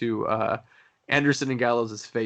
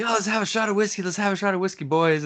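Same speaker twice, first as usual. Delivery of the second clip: high, hammed-up wrestler offering a drink; mock tough guy; 7 semitones higher, faster than usual. Mocking wrestlers' invitation.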